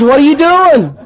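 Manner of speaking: high-pitched, scolding question; acted alarm. Friend catching him smoking a joint, mock alarm. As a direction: act out catching someone red-handed.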